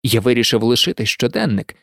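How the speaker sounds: ordinary delivery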